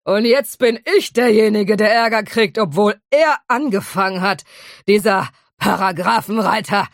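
put-on a drunk dragon whining in Liverpool dialect; lamenting in a Liverpool accent. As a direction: complain in broad Liverpool accent, aggrieved. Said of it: "Er lamentierte weiter".

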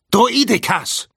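high, loud warning cry; alarmed, urgent call; excited shout. A narrator's reading. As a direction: yell out the danger in agitation. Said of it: "rief Obi-Wan aufgeregt".

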